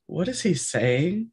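slow, puzzled retold question; baffled husband. Husband at a restaurant hearing true Cajun, mildly baffled and slow. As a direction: draw it out as if you can't understand.

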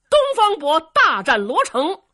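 loud, high storyteller's proclamation; cliffhanger announcement. A narrator's reading. Storyteller announcing the next episode's battle, cliffhanger announcement. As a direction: proclaim the coming battle at full force.

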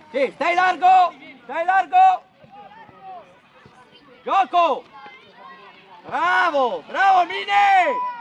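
full-voiced match encouragement; shouted coaching calls. Real, not acted. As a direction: call out to players across the pitch. Coach calling to players mid-match.

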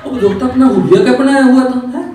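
high, loud berating; indignant master's outburst; scolding. Acted. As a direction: tear into the servant. Master berates servant over his looks.

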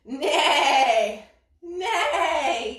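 neighing. Shown as a demonstration. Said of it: "what does a horse sound like".

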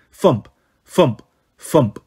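slow low thump, thump; low heartbeat thumps. A narrator's reading. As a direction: sound out a heartbeat. Imitated heartbeat.

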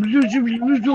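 imitating a bird's garbled talk; parrot babble imitation. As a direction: babble like a parrot attempting speech. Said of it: "like this".

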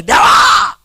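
very high, desperate-style cry out; acted high-pitched shout of invocation; shouting to God. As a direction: yell as if calling someone very far away. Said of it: "అరుస్తాడు".

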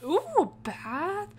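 normal talking voice

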